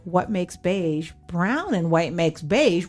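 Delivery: ordinary talk, nothing marked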